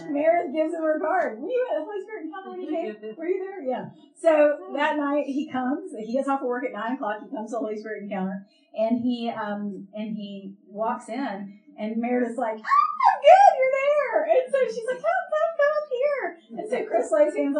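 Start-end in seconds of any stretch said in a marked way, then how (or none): 1.4-3.6 high, quick mimicked questions; eager questioning. Friend asks about the Encounter, high and quick. 12.6-14.2 high, loud excited squeal; thrilled greeting. Retold or imitated. Friend greets cashier walking in, squealing excitement. 15.0-16.2 very high, urgent mimicked call; excited beckoning. Friend calls cashier up for prayer, squealing excitedly.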